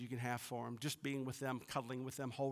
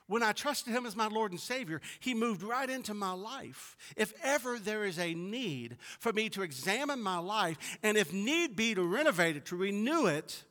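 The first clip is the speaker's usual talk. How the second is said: raised, emphatic preacher's delivery; louder, higher pulpit proclamation; preaching; 7 semitones higher, 7 dB louder than usual. Real, not acted. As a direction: preach it with building conviction. Preaching with force.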